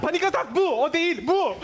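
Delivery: high, fast and frantic; panicked, insisting on the difference; agitated. Acted. Doctor shows real panic attack.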